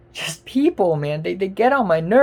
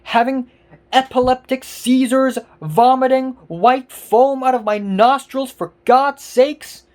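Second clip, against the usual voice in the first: louder, higher, indignant ranting; real exasperated outburst, building to a near-yell; angry rant; 5 semitones higher, 5 dB louder than usual. Real, not acted. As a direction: shout your disbelief at the commenters. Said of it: "I know I seem really angry right now".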